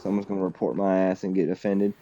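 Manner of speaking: ordinary talk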